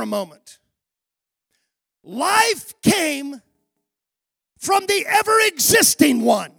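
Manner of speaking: loud, ringing sermon delivery building to shouts; full-force preaching. Acted. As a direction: preach it out to the congregation.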